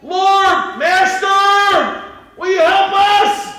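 high, loud desperate plea; crying out. Acted. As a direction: call out to him from far off. Lepers calling out to Jesus.

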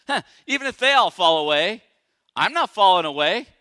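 loud scoffing boast; cocky Peter. Retold or imitated. Preacher as Peter boasting loudly over his buddies. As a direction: scoff and brag, chest out.

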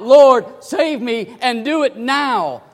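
loud, high plea for rescue; crying out. Acted. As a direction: call out from the sinking water. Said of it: "he cried out".

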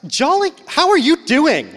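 high, loud, drawn-out gushing greeting; happiest phone voice. Acted. Teen answering the phone, putting on gushing happiness. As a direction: gush the hello with over-the-top cheer. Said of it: "you're the happiest person for no reason".

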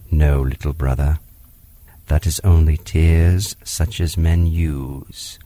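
tender panther. Acted. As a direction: comfort the crying boy. Bagheera comforts Mowgli.

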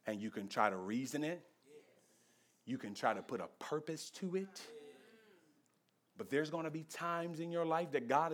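very low, very soft sermon voice; quiet, weighty counsel with long pauses; hushed solemn preaching. Acted. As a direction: bring the congregation down to a grave hush. Preacher drops to solemn hush.

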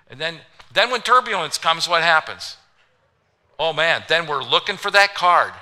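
usual speaking voice

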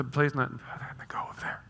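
teasing mumble fading to whisper; whispered nonsense. Retold or imitated. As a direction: let the words dissolve into playful whispering. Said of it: "I'd whisper sometimes just nonsense".

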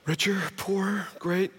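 quiet, bored sighing through the vows; mock-tired, ticking the vows off a list; husband reluctantly reciting vows each morning, bored; weary reading of vows. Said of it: "be like".

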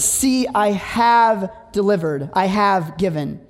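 "have" leaned on, slow; "See, I have delivered" with slow weight on "have"; stress on have. Shown as a demonstration. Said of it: "God speaks in past tense".

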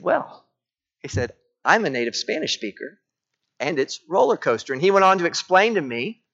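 normal talking voice

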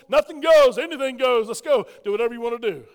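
loud and high, hammed-up wild church; mock excited shout. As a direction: shout it like a carnival crowd. Mocking chaotic churches.

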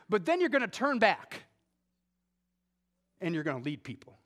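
impassioned sermon voice; full-force preaching. Acted. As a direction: drive the point home to the congregation.

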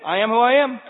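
high, loud, digging in her heels; stubborn grandma refrain. Retold or imitated. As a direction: plant your feet and refuse to budge.

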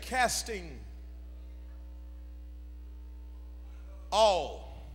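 "all" set apart after a long pause; stress on "all". Real, not acted. Preacher quoting scripture to the congregation, "all." isolated for emphasis. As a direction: hold back, then let "all." land alone. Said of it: "I have to emphasize the word all".

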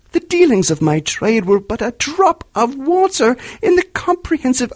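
a tormented spirit crying out; unearthly voice. A narrator's reading. Marley's ghost speaks.

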